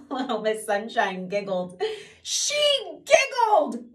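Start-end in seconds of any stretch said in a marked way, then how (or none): 2.2-3.9 very high, loud blubbering; blubbering king. Acted. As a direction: blubber it out through tears. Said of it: "blubbered the king".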